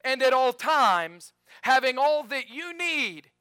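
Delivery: slow, high and loud; emphatic reading. Shown as a demonstration. Pastor reading the promise slowly and loudly. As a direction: stress "all". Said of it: "this strikes me".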